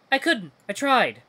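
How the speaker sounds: high, agitated self-defence; distressed protest. Acted. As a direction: plead that you did try.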